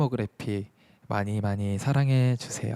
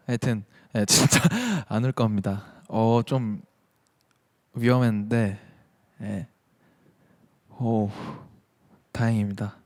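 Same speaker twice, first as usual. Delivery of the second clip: ordinary talk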